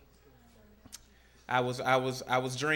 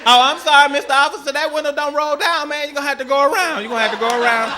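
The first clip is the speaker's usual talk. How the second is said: high, raised-voice mock apology; apologetic calling out; 8 semitones higher, 6 dB louder than usual. Driver to officer knocking on the wrong side, calling out apologetically. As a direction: plead loudly through a stuck window.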